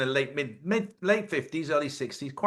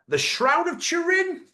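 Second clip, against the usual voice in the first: surprised; slower than usual. Acted. Surprised mock-incredulous repeat.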